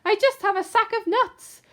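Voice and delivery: shivering squirrel. Acted. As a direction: squeak it, scared. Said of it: "the squirrel replied".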